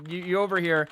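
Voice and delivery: ordinary delivery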